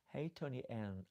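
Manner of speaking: normal talking voice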